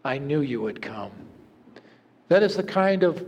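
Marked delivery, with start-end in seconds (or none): 0.1-1.1 much softer and lower, barely there; a wounded boy speaking with the last of his strength; a faint, weak reply from a dying soldier. A narrator's reading. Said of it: "he heard a faint voice".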